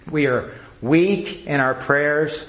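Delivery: ordinary talk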